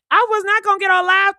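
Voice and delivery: fast loud retort. Real, not acted. Host answering her friend Tank's dare, loud and quick. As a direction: snap back at Tank.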